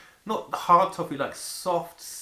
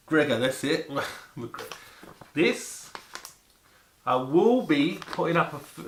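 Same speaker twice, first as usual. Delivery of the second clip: usual speaking voice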